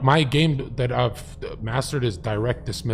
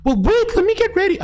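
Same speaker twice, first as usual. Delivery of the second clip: put-on far higher, flustered girl; high girly voice; 4 dB louder than usual. Mimicking how she'll respond, in a high girly voice. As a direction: squeak it out like a girl caught off guard. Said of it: "how she's gonna respond".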